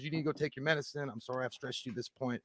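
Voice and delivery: quiet husband. Retold or imitated. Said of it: "He goes".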